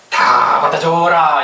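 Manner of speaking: mock solemn proverb; drawn-out saying. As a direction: intone an old saying. Quoting a saying slowly.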